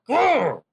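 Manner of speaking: loud bestial growl; barbarian growl. Acted. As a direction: snarl like a wild beast. Growl ends the threat.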